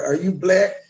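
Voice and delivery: usual speaking voice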